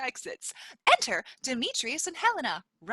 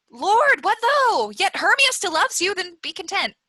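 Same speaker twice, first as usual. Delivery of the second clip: higher, louder pleading; pleading protest; 5 semitones higher, 5 dB louder than usual. Acted. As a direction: protest urgently to him. Helena's protest.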